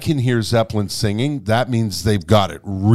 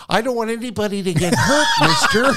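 laughing timid plea; 10 semitones higher, faster than usual. Retold or imitated.

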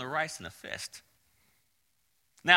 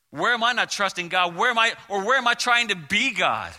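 rising preaching; 5 semitones higher, 6 dB louder than usual. Real, not acted. Preacher presses the congregation.

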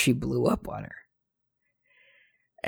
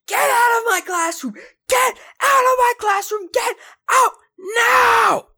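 very high, loud shrieking put-on teacher; imitated furious screaming; 5 dB louder than usual. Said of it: "she started screaming at the girl".

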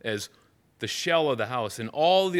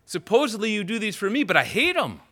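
higher and louder, divine indignation; God voiced rebuking the festivals, louder and higher; indignant rebuke; 9 semitones higher, 6 dB louder than usual. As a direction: thunder it back at them as God. Voicing God rebuking through Amos.

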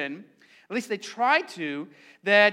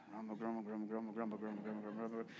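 low, much softer grumble, grumble; grumbling under breath; 10 semitones lower, 19 dB quieter, faster than usual. Shown as a demonstration. Pastor leading congregation in grumbling under their breath, low mutter. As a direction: mutter the words under your breath, arms folded. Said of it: "under your breath".

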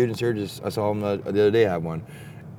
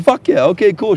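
mock cheerful; 8 semitones higher, 8 dB louder than usual. Faking cheer at campsite news.

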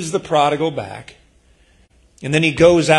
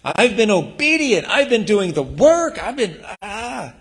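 resentful complaint; 6 semitones higher than usual. Acted. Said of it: "It's the angry obedience".

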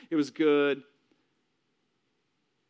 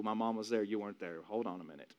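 low voice dropping softer; quiet firm rebuke; 4 semitones lower, 8 dB quieter than usual. Retold or imitated. Son defending his mom to dad, quiet firm rebuke. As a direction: settle into a low, controlled correction.

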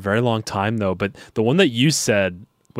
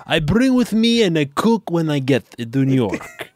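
higher, broken English in a put-on foreign accent; a butcher telling airport security he'll cook the bratwurst, in a put-on foreign accent; fake foreign accent; 6 semitones higher than usual. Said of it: "just put on an accent".

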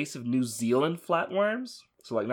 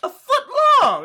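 high, loud shocked outcry; shocked shout; 14 dB louder than usual. Real, not acted. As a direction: yell it in disbelief. Shocked outburst about worm size.

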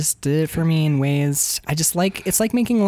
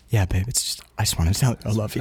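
much lower, rushed mock stenographer murmur; 10 semitones lower, 4 dB quieter, much faster than usual. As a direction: mumble it low and fast like the court typist. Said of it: "the stenographer voice in the back".

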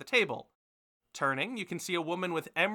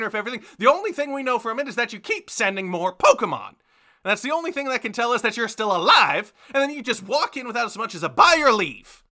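higher-pitched, exasperated tirade; scolding mother; 8 semitones higher, 6 dB louder than usual. Acted. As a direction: rant at your child, piling up complaints. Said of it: "glaring at him in a way that only mothers can glare".

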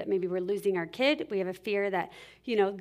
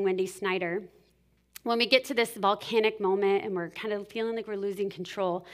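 ordinary talk

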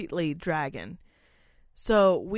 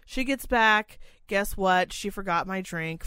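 ordinary talk, nothing marked

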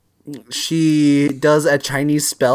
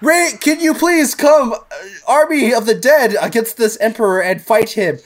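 pleading sorceress; 7 semitones higher than usual. Retold or imitated. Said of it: "she was just like".

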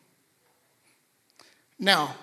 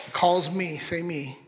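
ordinary talk, nothing marked

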